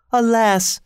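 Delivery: high-pitched lament of a grieving queen; lamenting cry. A narrator's reading. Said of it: "she cried to the Sultan".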